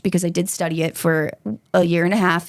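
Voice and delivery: ordinary talk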